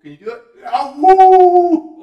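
very loud, high cries of effort; strained yelling. Real, not acted. Dad howling with strain in upward dog pose. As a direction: howl it out as the pose burns.

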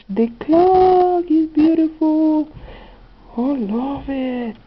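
high, slow, drawn-out admiration; delighted gushing. Real, not acted. Crafter admiring a clock piece for viewers, gushing. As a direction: gush in a high voice, stretching the words.

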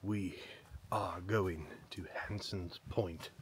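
slow hushed whisper. Acted. Hushed dramatic announcement.

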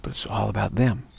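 normal talking voice

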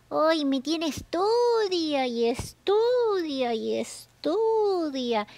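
slow whiny study study study; drawn-out complaint. Acted. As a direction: drag the words out wearily.

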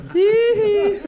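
high burst of laughter; loud laugh. Real, not acted. Big laugh.

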